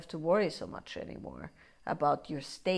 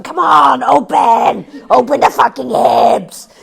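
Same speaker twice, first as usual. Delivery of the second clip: much louder, higher yelled demand; forceful yelling; 6 semitones higher, 18 dB louder than usual. Retold or imitated. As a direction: shout at your own hips to open. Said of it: "And you're going".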